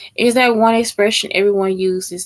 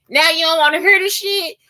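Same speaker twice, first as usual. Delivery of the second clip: higher, indignant challenge; mock indignation; 7 semitones higher than usual. Texan confronts someone walking away, mock indignation. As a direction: challenge them in mock outrage. Acted quarrel with fleeing listener.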